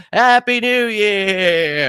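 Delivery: slow, high sarcastic cheer; mock cheer. Host mocking his own New Year cheer, sarcastic. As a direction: fake a festive greeting. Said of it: "I'm gonna be".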